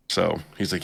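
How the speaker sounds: ordinary talk, nothing marked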